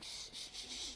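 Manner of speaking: soft, whispered shushing sounds; mock parents hushing a baby; imitated frantic hushing. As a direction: shush like an anxious new parent. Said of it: "they're like".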